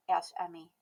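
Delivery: plain reading voice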